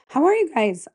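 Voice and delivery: ordinary delivery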